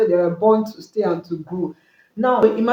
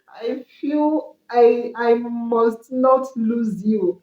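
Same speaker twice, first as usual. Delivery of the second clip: retold crying; 2 semitones higher, much slower than usual. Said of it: "he started to cry".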